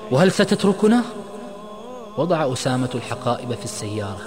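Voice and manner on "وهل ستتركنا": high, loud, upset question; agitated. A narrator's reading. Friend fearing Osama will leave them, agitated. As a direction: let the voice leap up in agitation. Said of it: "قال عماد وهو منفعل".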